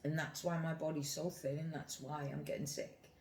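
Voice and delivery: normal talking voice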